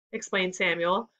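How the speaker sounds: usual speaking voice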